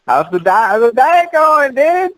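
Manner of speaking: high, slow teasing question. Real, not acted. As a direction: needle him about the diet. Teasing question about the diet.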